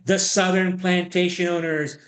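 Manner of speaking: ordinary talk, nothing marked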